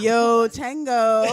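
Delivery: slow beginner Spanish, amused; beginner's Spanish. Shown as a demonstration. Showing off Duolingo practice, slow beginner's Spanish. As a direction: spell out Spanish slowly with a learner accent. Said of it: "been practicing my Spanish on Duolingo".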